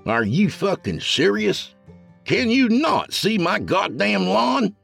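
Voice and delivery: angry hillbilly. A narrator's reading. Neighbor angry about his lawn.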